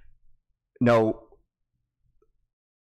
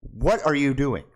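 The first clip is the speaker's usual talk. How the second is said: ordinary talk, nothing marked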